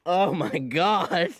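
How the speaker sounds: drawn-out, high cry of disgust; appalled exclamation. Acted. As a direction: stretch it out, pitched high with dismay. Disgusted reaction to a sneeze.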